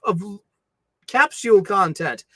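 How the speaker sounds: ordinary talk, nothing marked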